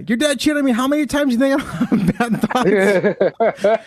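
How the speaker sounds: high, slow mom voice breaking into laughter; hammed-up mother retort with a laugh; mock mom. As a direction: play the mom trumping your troubles. Mom one-upping her son.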